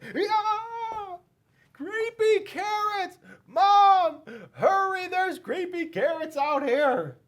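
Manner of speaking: put-on high, loud kid yelling with a laugh; delighted boy shouting to his mom; excited yelling. A narrator's reading. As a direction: call Mom over, thrilled and giggling. Jasper excitedly calling his mom.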